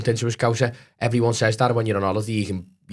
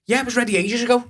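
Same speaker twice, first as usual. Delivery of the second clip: much higher, cheeky fast comeback; quick cocky reply; 13 semitones higher, faster than usual. Retold or imitated. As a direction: snap back ready and cocky. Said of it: "like that".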